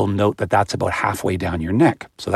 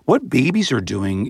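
ordinary talk, nothing marked

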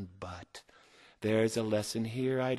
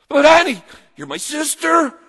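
high, loud hammed-up wail; blubbering whine; 15 dB louder than usual. Joe begging his sister to spare him, mock blubbering. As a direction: plead like a whiny kid brother. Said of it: "Joe blubbered and he whined".

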